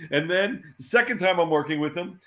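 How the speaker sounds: ordinary delivery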